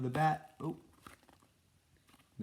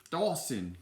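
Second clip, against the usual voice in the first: louder, higher cry of a name; reproachful cry; 5 semitones higher, 5 dB louder than usual. A narrator's reading. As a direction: cry out at a companion. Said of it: "cried Basil".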